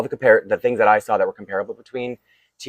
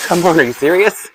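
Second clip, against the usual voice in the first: exasperated; 9 semitones higher, 8 dB louder than usual. Real, not acted. Both boxes arrived upside down.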